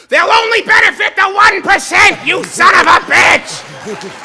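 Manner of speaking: hammed-up shouting rant; Bernie Sanders rant. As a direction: scream it like a furious old senator. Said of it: "Bernie Sanders for years has been screaming".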